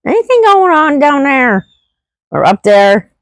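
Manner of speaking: high, breezy psychic-friend voice; playful mystic check-in. Retold or imitated. Calling my friend to ask if anything's wrong, high and lilting. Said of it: "and I'm like".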